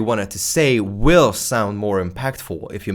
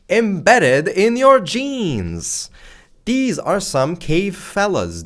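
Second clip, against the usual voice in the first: playful emphasis; 9 semitones higher, 3 dB louder, much slower than usual. Acted. Hyping the caveman idea.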